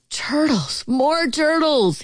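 high, stretched royal call; hammed-up calling out; mock bellow. A narrator's reading. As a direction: bray the order without real volume. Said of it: "he bellowed and brayed".

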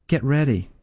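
usual speaking voice

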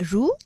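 "ru" with a light tongue tap on the r; tapped r. Shown as a demonstration. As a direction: briefly roll the r of "ru". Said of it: "a light tongue tap and a short rolled r".

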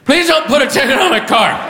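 high-pitched, unthinking blurt; blurted plea. Acted. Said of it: "I'm in blurt mode".

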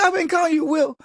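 high, stunned admission; shocked realization. Retold or imitated. Realizing he had been calling his friend the wrong name, shocked and high. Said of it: "I go".

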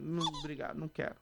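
ordinary talk, nothing marked

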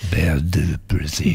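low, breathy French title; sexy voice. Acted. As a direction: purr Belle du Brésil. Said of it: "You like that sexy voice".

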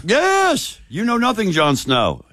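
high delighted yes, then mock character quote; Ygritte. Host seizing on a co-host's comparison, playful character impression. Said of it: "Sounds like Jon Snow's wife".

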